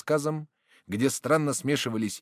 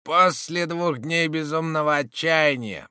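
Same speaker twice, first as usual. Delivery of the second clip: grim storyteller; 7 semitones higher, 6 dB louder than usual. Acted. Roden resumes grim tale.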